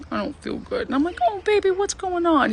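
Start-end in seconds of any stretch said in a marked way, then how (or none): 0.1-0.9 whiny man. Retold or imitated. Sick man whining. 1.3-2.5 put-on babying a sick boyfriend; cooing. Cooing over sick man.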